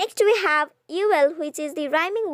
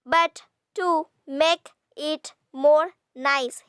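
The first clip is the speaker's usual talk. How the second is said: "But to make it more nice" slow, a pause after each word; syllable by syllable; slower than usual. Shown as a demonstration. Teacher counting syllables, "But to make it more nice" slowly separated. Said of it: "here we have six syllables".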